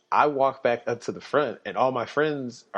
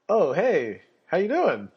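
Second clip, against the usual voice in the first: higher, bright friendly cop greeting; 8 semitones higher, 4 dB louder than usual. Retold or imitated. Officer at the car window greets the driver, friendly higher voice. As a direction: greet him like an old buddy.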